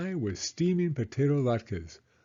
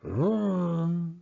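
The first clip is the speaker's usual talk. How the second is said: higher rolling growl; bear growl; 7 semitones higher than usual. Acted. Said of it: "he growled".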